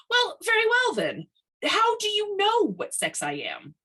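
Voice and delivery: high, loud challenging voice; sore challenge. A narrator's reading. McKnight challenges Tiny, high and sore. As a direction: challenge him, high and sharp.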